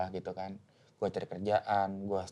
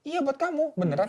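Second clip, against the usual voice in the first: much higher, playful parent reply; mock parent voice; 5 dB louder than usual. Parent answering child's disbelief, high playful voice. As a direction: tease the kid with a sweet yes.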